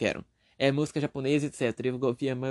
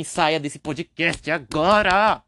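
loud, slow order swelling on the last word; mock stern shout; 7 dB louder, much slower than usual. Host ordering very young listeners off the podcast, mock shouting. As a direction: draw it out into a playful yell.

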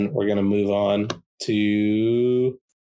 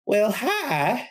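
put-on much higher, sweet gnome girl; little girl voice; 12 semitones higher than usual. Gnome girl greeting the newcomer, much higher sweet voice. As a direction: chirp a greeting up high.

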